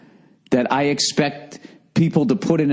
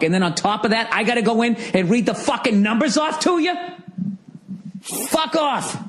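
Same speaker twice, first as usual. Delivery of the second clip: furious shout; 10 semitones higher than usual. Real, not acted. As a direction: explode at the bank, then spit out the curse. Rant at bank, building to shout.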